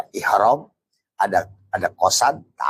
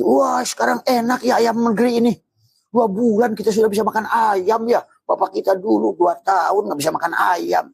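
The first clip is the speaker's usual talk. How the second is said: gushing mock delight; 13 semitones higher than usual. Mimics people praising broiler chicken.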